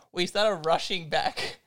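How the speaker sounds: ordinary delivery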